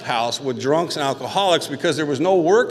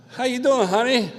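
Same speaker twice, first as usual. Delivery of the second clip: much higher mock-sweet voice; girlfriend's fake-sweet phone greeting; sugary girlfriend; 10 semitones higher than usual. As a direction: coo it like a syrupy girlfriend. Girlfriend answering collect call sweetly.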